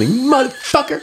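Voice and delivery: shrill, high-pitched mock scream of rage; retold furious yell. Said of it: "I'm screaming at them".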